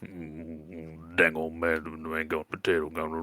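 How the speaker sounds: low, rambling country mumble; mumbling lizard folk, complaining. Acted. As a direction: grumble along low and slurred, words running together.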